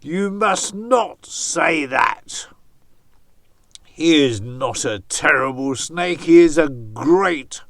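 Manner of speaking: loud, stern scolding; stern Anansi. Acted. Anansi sternly scolding imagined villagers so the snake hears, loud. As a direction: order them off sternly. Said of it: "said in his Anansi voice very sternly".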